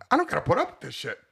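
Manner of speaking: hammed-up indignant comeback, pitch jumping at the end; mock fed-up retort. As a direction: play a guy who's had enough, voice rising. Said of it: "You're like".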